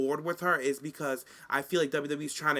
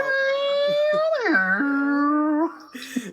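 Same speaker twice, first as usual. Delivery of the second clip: big burst of laughter, pitched way up; loud high laugh; 12 semitones higher, 8 dB louder than usual. Real, not acted. Laughing loudly at Natty showing up on the show. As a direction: cackle out loud in delight.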